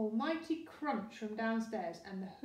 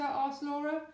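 plain reading voice

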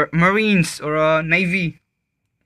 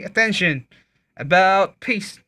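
calm drill commands; 4 semitones higher, slower than usual. Shown as a demonstration. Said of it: "say attentions in normal and more loud way, not screaming".

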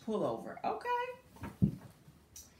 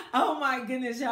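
ordinary talk

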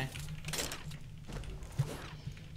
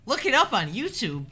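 usual speaking voice

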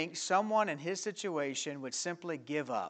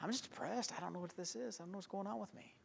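quiet; 4 semitones higher, 13 dB quieter, faster than usual. Retold or imitated. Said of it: "I said".

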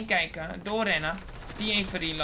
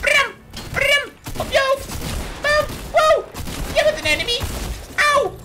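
very high, loud battle yells; in combat, squealing; frantic shrieks; 10 dB louder than usual. Real, not acted. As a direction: shriek through the fight. Fight, shrieking.

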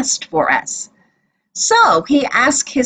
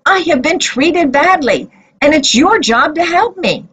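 mild shout; 6 semitones higher than usual. A narrator's reading. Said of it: "she shouted".

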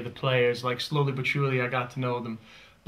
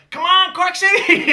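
high, loud, slow American football chant; drawn-out loud shout to rally the team; shouted cheer; 9 dB louder, slower than usual. Retold or imitated. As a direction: holler it high from the stands, American accent. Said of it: "I shout out".

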